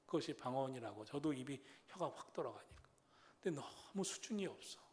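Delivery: usual speaking voice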